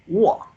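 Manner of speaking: "wo" starting high and falling, loud; fourth tone. Shown as a demonstration. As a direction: drop "wo" firmly from the top. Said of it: "high to low".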